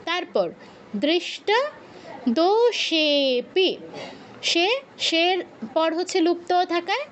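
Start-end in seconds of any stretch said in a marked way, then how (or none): 1.0-3.7 দোষেপি spoken very slowly with সে held longest; very slow drawn-out demo. Shown as a demonstration. Teacher slowly drawing out দোষেপি for the class. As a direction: stretch দোষেপি out slowly, holding সে. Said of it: "সেতে প্লুত উচ্চারণ হবে".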